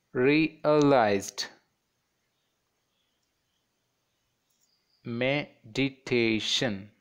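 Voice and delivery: "realized, meditation" broken into separate beats; split into syllables. Shown as a demonstration. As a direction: sound out each syllable. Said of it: "divide these words, fourteen words".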